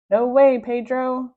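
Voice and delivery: a hero brushing off the bully; cry out. Acted. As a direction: toss off a breezy no. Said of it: "cried Captain Underpants".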